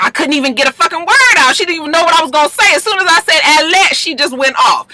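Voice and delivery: high, fast, exasperated rant; imitating an indignant friend, fast and high; worked-up retelling. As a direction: rattle it off like you're still fuming. [name] retells the confrontation.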